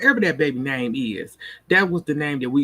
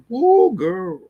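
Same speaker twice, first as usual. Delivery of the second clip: higher, louder whoop of delight; excited whoop; 6 semitones higher, 6 dB louder than usual. Real, not acted. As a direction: cheer at the screen like a fan. Reacting to the actor's big moment.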